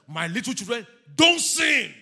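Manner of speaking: high, loud preaching cry; shouted exhortation. Acted. Preacher quoting scripture to his little children, forceful high shout. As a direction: drive the command home at full force.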